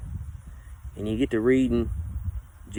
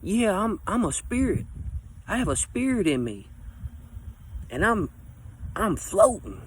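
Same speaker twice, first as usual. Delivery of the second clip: stoned musing; 7 semitones higher, 3 dB quieter than usual. Retold or imitated. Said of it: "you sitting there and you like".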